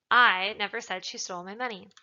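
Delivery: emphatic "I", the rest unstressed; stress on I. Shown as a demonstration. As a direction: punch the first word "I". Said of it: "implies that somebody else said it".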